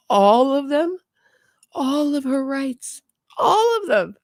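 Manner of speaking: high, wailing mock sob; fake-cry it like a spoiled complainer; mock crying whine. Mocking Donna's rights complaint.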